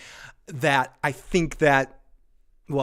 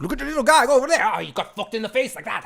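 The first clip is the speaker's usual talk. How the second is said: high, fast commentator voice; Aussie commentator impression; 8 semitones higher, faster than usual. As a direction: do a rowdy Aussie YouTube narrator. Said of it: "make commentaries on what they're watching".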